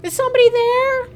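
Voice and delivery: high, loud screechy calling; high-pitched screechy old voice; screechy old patient. Retold or imitated. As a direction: wail down the hall like a frail old patient. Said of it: "high-pitched, screechy voice".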